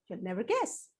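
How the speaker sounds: ordinary delivery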